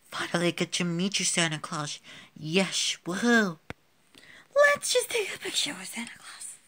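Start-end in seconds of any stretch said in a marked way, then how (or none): 4.6-6.6 high excited whisper; breathy secret whisper to a friend. Acted. Excited aside at Santa visit.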